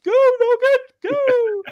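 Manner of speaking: very loud, high, drawn-out yell; hammed-up sideline roar; mock loudspeaker shout. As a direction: holler it as if blasting across a rugby pitch. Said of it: "we'll shout that".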